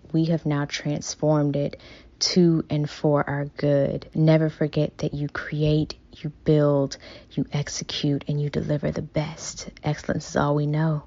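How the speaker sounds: ordinary delivery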